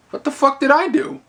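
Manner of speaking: high-pitched, disbelieving self-defence; retold squeal of innocence, not shouted; mock incredulous protest. Clerk told of a complaint by manager, incredulous protest.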